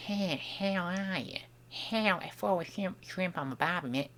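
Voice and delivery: half-formed Australian accent, under the breath; attempted Australian accent. Shown as a demonstration. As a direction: try out a stock Aussie voice quietly. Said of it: "the way to do that accent yet".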